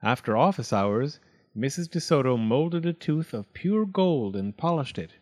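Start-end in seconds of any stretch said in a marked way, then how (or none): none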